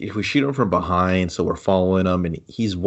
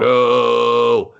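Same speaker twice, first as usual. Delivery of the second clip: hammed-up movie cry, a little louder; a put-on dramatic no; mock anguished no; 2 semitones higher, 4 dB louder than usual. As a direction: play it like a cheesy film hero.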